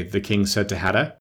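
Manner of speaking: ordinary delivery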